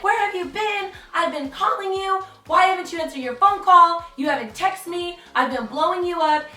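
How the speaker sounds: a hint of a furious girlfriend screaming; mock angry yell. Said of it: "this girl starts screaming at the top of her lungs".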